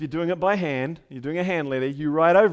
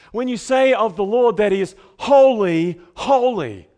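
louder, leaning hard on holy; raised voice on the doubled holy; stronger emphasis; 4 semitones higher, 9 dB louder than usual. Shown as a demonstration. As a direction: build the stress up a notch. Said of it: "that's something more".

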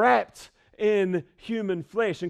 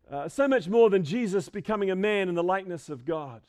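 ordinary delivery

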